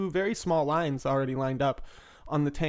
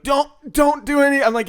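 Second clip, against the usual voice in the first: loud plea; 11 semitones higher, 10 dB louder than usual. Retold or imitated. Panicked retold plea.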